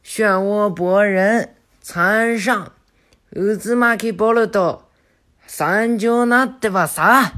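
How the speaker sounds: high, loud, slow proud shout; shouting his name. Acted. Boruto on the train roof announcing himself, slow loud shout. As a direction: proclaim his name to the whole school. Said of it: "他就喊".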